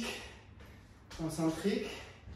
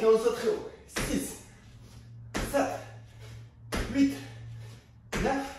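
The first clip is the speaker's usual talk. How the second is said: comptage encouragé; 5 semitones higher than usual. Real, not acted. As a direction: call each count out like a coach. Coach counts jumping reps aloud. (compter fort pour motiver)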